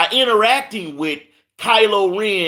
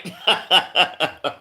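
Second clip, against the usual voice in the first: short chuckling laugh; light run of giggles; self-amused laugh. Real, not acted. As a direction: chuckle at your own careful attempt. Laughs after careful name attempt.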